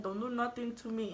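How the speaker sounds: ordinary talk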